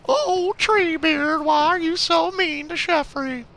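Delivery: very high, slow whining plea; whiny high voice. Acted. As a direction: whine it like a wounded child. Whiny mock plea to boss.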